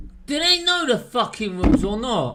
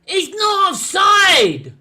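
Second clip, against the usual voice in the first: loud, slow, outraged shout; furious shout; 9 semitones higher, 5 dB louder, slower than usual. Real, not acted. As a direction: yell it at the ref. Shouting at referee.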